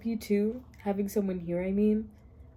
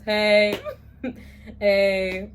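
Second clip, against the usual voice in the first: ordinary delivery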